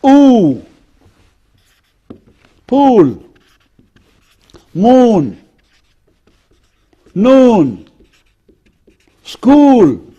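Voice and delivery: "oo", "pool", "moon", "noon", "school" with long rounded oo; long OO vowel. Shown as a demonstration. Teacher demonstrating a vowel, long "oo" as in "pool", "moon". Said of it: "And then you have".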